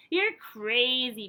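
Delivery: loud, slow scoffing; mock the dreamer; scornful villagers. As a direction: villagers jeering at Manji. Villagers scold Manji in story.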